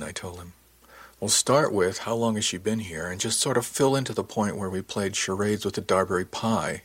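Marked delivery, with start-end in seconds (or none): none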